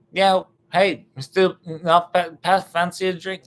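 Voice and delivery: high, loud mock British, stumbling over words; British accent. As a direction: fumble through a put-on British voice. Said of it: "with the British person".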